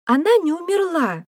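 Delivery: put-on very high, loud child's shout; high-pitched excited yell; girl's cry. A narrator's reading. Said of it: "крикнула Димфна".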